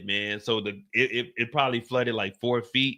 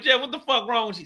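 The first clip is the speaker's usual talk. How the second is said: alarmed call; 10 semitones higher than usual. Retold or imitated. Alarmed at sick Jeff.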